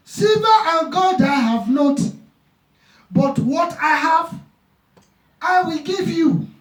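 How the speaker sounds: high, slow ringing proclamation; fervent preaching. Acted. Preacher declaiming Peter healing the lame man, high and slow. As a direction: proclaim Peter's words slowly to the congregation.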